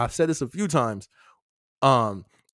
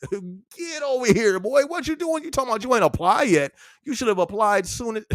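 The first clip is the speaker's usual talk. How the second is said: playful scolding; 7 semitones higher than usual. Acted. Streamer playfully scolding Isaiah for not applying yet. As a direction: scold him like a big brother, half joking.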